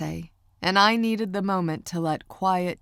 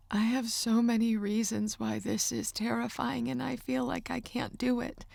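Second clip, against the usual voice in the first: a touch higher and softer, worried; anxious young woman, higher; 6 semitones higher, 5 dB quieter than usual. A narrator's reading. Niece admits her fear to uncle, lightly voiced higher.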